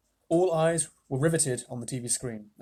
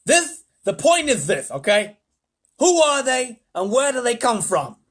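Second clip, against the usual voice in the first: loud high agitated president; excited president; 7 semitones higher, 7 dB louder, slower than usual. Acted. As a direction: demand answers urgently. President demanding answers.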